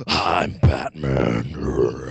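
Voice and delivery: very deep, very slow growl; Batman voice. Acted. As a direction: drag every word out low. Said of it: "Batman goes".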